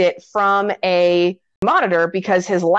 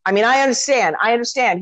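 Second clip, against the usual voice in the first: ordinary delivery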